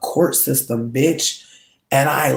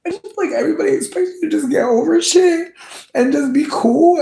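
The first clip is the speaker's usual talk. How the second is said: high, loud, close to tears; real hurt breaking through, fast and cracking; 5 dB louder than usual. Real, not acted. Venting pain, voice breaking.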